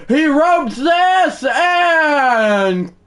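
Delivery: shouted call. Acted. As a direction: start yelling the hit like a broadcaster. Announcer call cut short.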